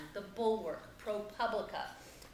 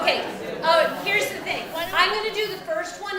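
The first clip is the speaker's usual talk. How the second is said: loud; 8 semitones higher, 10 dB louder than usual. Real, not acted. Gets the room's attention.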